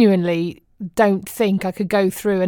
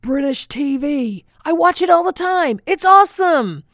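enthusiastic American man; 4 semitones higher than usual. Retold or imitated. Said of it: "he said".

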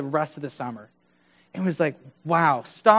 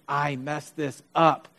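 slow, lowered self-blame; stewing in condemnation; 4 semitones lower, 4 dB quieter, slower than usual. Retold or imitated. Preacher voices his own guilty thought, downcast. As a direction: sink slowly into self-reproach. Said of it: "I will stew in condemnation".

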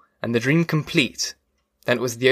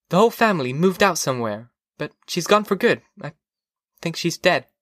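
Mildred, offhand; 5 semitones higher than usual. Acted. Wife recalls the neighbour girl to her husband, offhand. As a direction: say it vaguely, pitched up.

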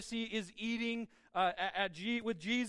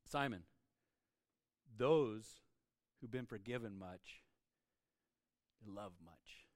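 hushed tender teaching; 10 semitones lower, 7 dB quieter than usual. Retold or imitated. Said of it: "He goes like this".

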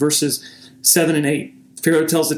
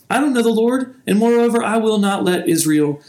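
defiant Pharaoh; 6 semitones higher than usual. Acted. Preacher voicing Pharaoh's refusal to God, high and defiant. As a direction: throw the refusal back like a proud king.

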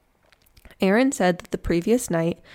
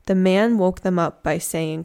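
ordinary talk

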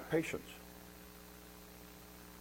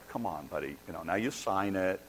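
low, softer mock of a cashier; frustrated cashier; 6 semitones lower, 11 dB quieter than usual. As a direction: imitate quietly strained patience. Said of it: "even the cashier's frustrated".

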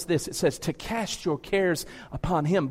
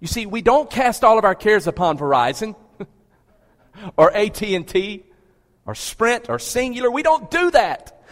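preaching at full force; 3 semitones higher, 8 dB louder than usual. Acted.

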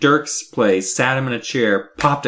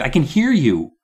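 crying out; 4 semitones higher than usual. A narrator's reading. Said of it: "I cried".

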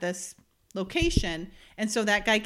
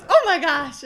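mock startled cry; 8 semitones higher, 11 dB louder than usual. Retelling Kate startled by Emily.

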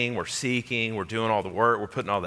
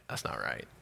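inner grumble; 12 semitones lower, 13 dB quieter than usual. Retold or imitated. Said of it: "I remember thinking".

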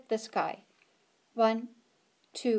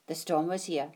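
ordinary delivery, nothing marked

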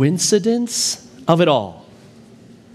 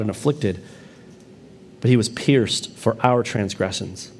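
lower, softer read-aloud of scripture; 8 semitones lower, 3 dB quieter than usual. Acted. Pastor reading Isaiah to the congregation, lower and softer scripture reading. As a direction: read the prophecy lower and softer, letting it sink in.

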